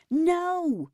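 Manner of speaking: anguished high, loud cry; howled. A narrator's reading. Neil refusing the therapist's charge, howling no. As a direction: howl the denial in despair. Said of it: "Neil howled".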